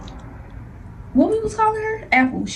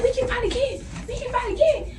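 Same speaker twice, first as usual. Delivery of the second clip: much higher, softer mocking taunt; sing-song dare, pitched way up; taunting girl; 11 semitones higher, 6 dB quieter than usual. The daughter daring her to fight again, high soft mocking taunt.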